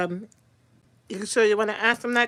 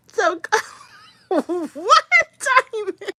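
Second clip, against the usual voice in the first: ordinary delivery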